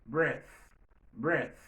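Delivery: "breath" ending on an airy, voiceless th; voiceless final th. Shown as a demonstration. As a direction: say "breath" with short e and no buzz on the th. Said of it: "li on voice". ("breath" ak th san vwa)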